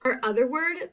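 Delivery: ordinary talk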